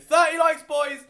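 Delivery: loud, high cheer to the chat; big happy cheer for the likes; excited cheer. Real, not acted. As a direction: whoop it out to the viewers. Celebrating thirty likes on stream.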